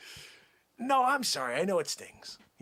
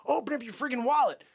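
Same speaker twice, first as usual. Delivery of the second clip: ordinary delivery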